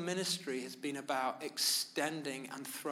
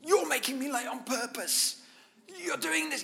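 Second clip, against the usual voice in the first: much higher, frantic accusing voice; panicked blaming self; 10 semitones higher, 4 dB louder than usual. Retold or imitated. Preacher imitating himself panicking about being late, high frantic blame. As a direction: play your own panicky, blaming self. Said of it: "I start to blame".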